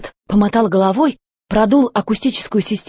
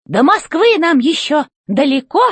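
robot chef voice; 6 semitones higher than usual. A narrator's reading. Robot chef demanding answers from the old robot, high and commanding. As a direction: bark orders from up high like a tin general.

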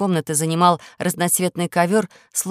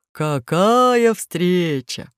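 wolf voice; 3 dB louder, much slower than usual. Acted. Wolf voice in story.

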